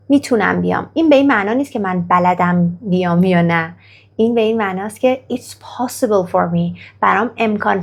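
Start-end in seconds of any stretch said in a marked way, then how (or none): none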